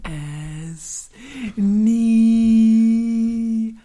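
very slow snail drawl, NIE loud. Acted. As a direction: drag it out, calm and unhurried. Snail voice held.

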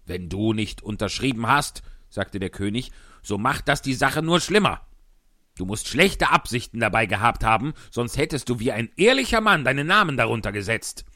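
loud, high blustering king; pompous king. Acted.

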